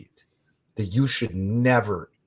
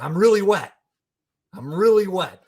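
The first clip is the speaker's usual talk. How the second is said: high, loud retold whine of protest; mock protest; 11 semitones higher, 7 dB louder than usual. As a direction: protest insistently in a raised, high pitch. Retelling his protest to the woman.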